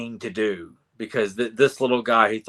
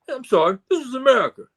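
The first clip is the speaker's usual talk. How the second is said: high cartoon character voice; high-pitched cartoon protest; South Park impression; 14 semitones higher, 4 dB louder, faster than usual. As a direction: quote it in a very high comic voice. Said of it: "There's a South Park reference for you".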